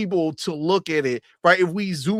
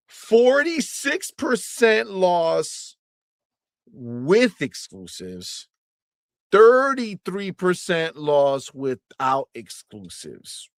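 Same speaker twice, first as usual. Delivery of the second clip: slow, deliberate comparison with long pauses; drawn-out quiz question; slow, emphatic; much slower than usual. Shown as a demonstration. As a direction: lay it out slowly, piece by piece. Spelling out the math for viewers.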